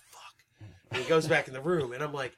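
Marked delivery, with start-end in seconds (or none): 0.1-0.7 breathy whispered fuck under the breath; a sharp whispered fuck; whispered curse. Retold or imitated. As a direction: mutter it unvoiced to yourself.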